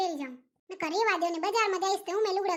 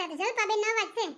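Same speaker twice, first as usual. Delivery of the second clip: normal talking voice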